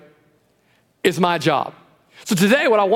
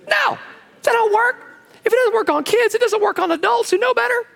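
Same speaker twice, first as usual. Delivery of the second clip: exclaiming. Real, not acted. Preacher protests to congregation, high emphatic exclaim. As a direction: cry it out to the room in high emphasis.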